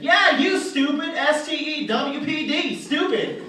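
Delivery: high, loud, slow insult. Acted. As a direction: spell out the insult in loud indignation. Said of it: "He gets all offended".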